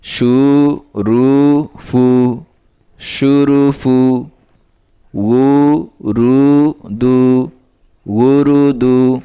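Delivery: measured, chanting recital of spelled syllables; recitation drill. Shown as a demonstration. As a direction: read each syllable out slowly like a teacher drilling a class. Revision recital. (ধীরে ধীরে সুর করে বানান পড়া)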